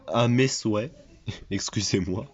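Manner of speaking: ordinary talk, nothing marked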